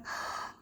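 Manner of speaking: acted sharp intake of breath; shocked gasp. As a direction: gasp as if seeing a double. Acts out seeing the double.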